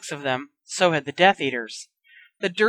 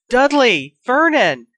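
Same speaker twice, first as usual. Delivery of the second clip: high, loud call for her family; loud, high alarmed cries; frantic cry; 6 semitones higher, 6 dB louder, slower than usual. Acted. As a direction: shout for them to come now. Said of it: "Aunt Petunia cried".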